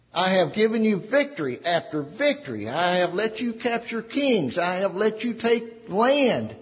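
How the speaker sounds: voice of God. Acted. Preacher voicing God's rebuke to Israel. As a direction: let God grieve over his people. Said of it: "this is God talking".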